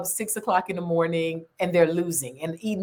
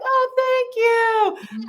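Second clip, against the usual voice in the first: slow, much higher sugary thank you; mock flattered reply; 4 dB louder, much slower than usual. Retelling the Clubhouse lady, a slow high thank you. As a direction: play up being flattered.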